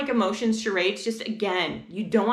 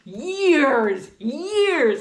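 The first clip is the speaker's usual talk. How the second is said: Years, years drawn out, louder and higher; stress on years; 4 semitones higher, 5 dB louder than usual. Real, not acted. Mom stressing how long the work took, emphatic. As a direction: lean hard on years. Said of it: "years I worked with this one kid".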